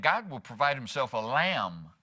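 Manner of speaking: lingering emphasis on the final lamb; stress on LAMB. Shown as a demonstration. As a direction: save the weight for the word lamb. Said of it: "we just emphasize different words".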